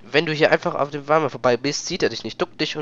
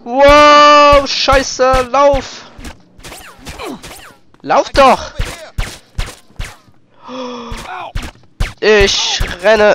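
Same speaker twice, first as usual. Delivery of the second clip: high panicked shouting; yelling run while being chased; 11 semitones higher, 10 dB louder, much slower than usual. Real, not acted.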